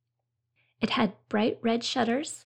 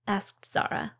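ordinary delivery

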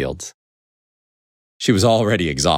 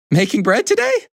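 high-pitched curious question; big hen character; 3 dB louder than usual. A narrator's reading. Big hen seeing the basket, high character voice. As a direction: ask up high as a chatty hen.

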